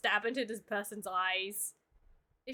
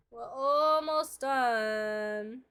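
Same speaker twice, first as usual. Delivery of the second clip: drawn-out sing-song; much slower than usual. Acted.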